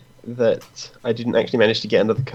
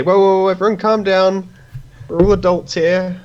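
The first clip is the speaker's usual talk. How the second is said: raised, high calming-down shout; 9 semitones higher, 4 dB louder than usual. Acted. Player's character with hands up halting a fight, shouted high. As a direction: call out urgently to settle everyone. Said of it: "you jump up and shout that".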